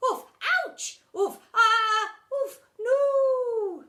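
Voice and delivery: rising yelps ending in a high cry; bowled-over kids crying out; children yelping. Acted.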